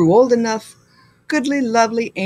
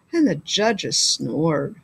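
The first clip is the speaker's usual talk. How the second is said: sleepy drawl; 6 semitones lower, 3 dB quieter, slower than usual. A narrator's reading. Bored judges fall asleep.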